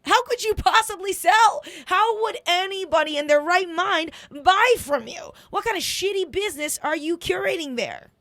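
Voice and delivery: loud, high mock outrage at a lifeless recruiter; outraged rant. Speaker mocks a flat recruiter, loud and outraged. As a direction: blast her with disbelief. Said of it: "I was like".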